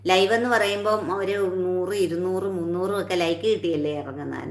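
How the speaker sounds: normal talking voice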